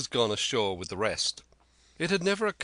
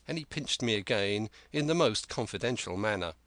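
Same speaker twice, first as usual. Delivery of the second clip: ordinary delivery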